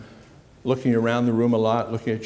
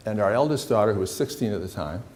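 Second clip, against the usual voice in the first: normal talking voice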